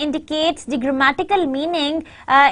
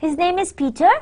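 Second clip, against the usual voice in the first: "Peter" lifted in a courteous rise; polite question; 3 semitones higher, much faster than usual. Shown as a demonstration. Teacher modelling a polite question, rising tune on Peter. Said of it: "That's a polite question".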